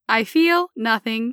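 slow, emotionless robot. Acted. Patient proving she has no feelings, robotic voice. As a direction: state it like a machine. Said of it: "I'll try it again as a robot".

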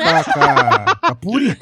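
stammering through laughter; cracking up mid-sentence; laughing. Real, not acted. Laughing at the ship joke.

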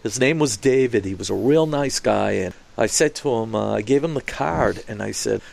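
ordinary talk, nothing marked

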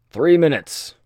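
loud high call across the platform; shout. Acted. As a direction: bellow it out to everyone. Countdown called across the platform.

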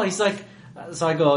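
usual speaking voice

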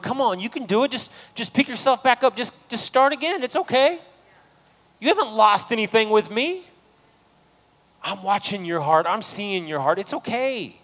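high, gentle, coaxing encouragement; lightly voiced God, lifting them up; encouraging God.

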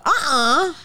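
high-pitched nuh-uh of protest; mock refusal. Patient objecting to the weight at the doctor, mock refusal.

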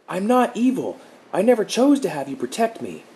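very high, loud pleading cry; desperate protest. A narrator's reading. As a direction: push back with all he has.

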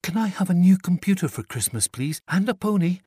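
put-on a child rushing her wishes out; eager little girl. A narrator's reading. Chloe asking Santa.

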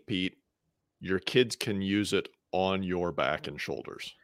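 ordinary talk, nothing marked

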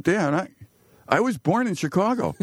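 put-on a boy telling his dad he's native; childhood self to dad. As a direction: pipe up like a proud little kid.

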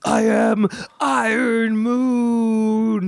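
very slow wailing superhero parody; bad acting. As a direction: drag it out, mock-crying hero. Iron Man parody line.

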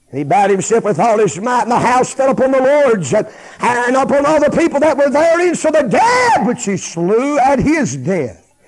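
loud, high shouted scripture, slowing at the end; full-force preaching. Real, not acted. Preacher telling of Samson's last act, shouting at full force.

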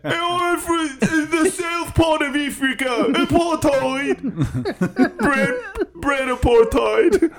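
very high, stretched fake South African accent; hammed-up South African put-on, breaking into laughter; bad South African accent. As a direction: drag out words in a squawky mock South African accent. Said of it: "one-size-fits-all accent".

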